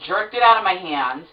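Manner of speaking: ordinary delivery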